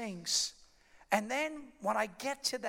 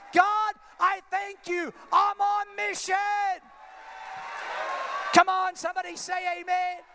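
high, loud shouted preaching climax; full-force preaching; 10 semitones higher, 6 dB louder than usual. Acted. Preacher rallies the congregation, high loud shouted preaching. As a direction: preach it at the top of your voice.

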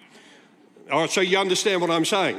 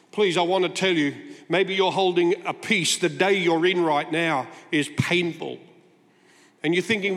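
ordinary delivery

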